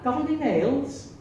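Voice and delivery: sly leprechaun asking, drawn out. Acted. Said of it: "she's playing the part of a leprechaun".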